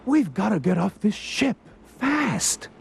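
high, urgent exclamation; urgent cry. A narrator's reading. Ralph urging Eduardo to flee the ship, urgent cry. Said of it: "cried Ralph".

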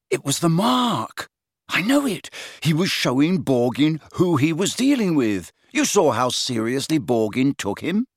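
high-pitched, urgent certainty; emphatic, heated conviction; stubborn insistence. A narrator's reading. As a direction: argue excitedly to convince doubters. Harry insisting on his theory.

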